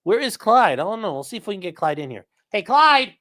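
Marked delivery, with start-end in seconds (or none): 2.5-3.1 loud call to the dog. Real, not acted. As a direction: call the dog from across the house. Calling the dog into room.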